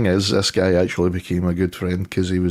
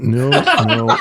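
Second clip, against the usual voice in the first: laughing no; 4 semitones higher, 3 dB louder than usual. Retold or imitated. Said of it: "I'm like".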